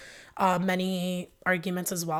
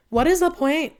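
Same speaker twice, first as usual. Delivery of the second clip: exasperated; 9 semitones higher, 7 dB louder than usual. Retold or imitated. Her exasperated retort.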